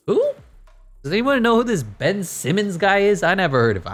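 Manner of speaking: high, put-on baffled voice; sarcastic fake confusion; mock clueless. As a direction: play dumb about who he is. Mock not knowing star player.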